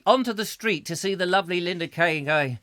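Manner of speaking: normal talking voice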